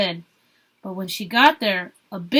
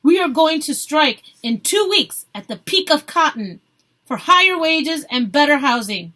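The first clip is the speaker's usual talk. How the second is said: loud, higher announcement; declaring loudly; 4 semitones higher, 6 dB louder than usual. A narrator's reading. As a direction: proclaim the strike to the crowd. Protester announcing strike.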